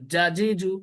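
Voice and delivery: জাজিজু with a very hard, firm জ; very hard jim. Shown as a demonstration. Teacher showing students a very hard জ, firmly. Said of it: "একদম শক্ত করে বলবেন".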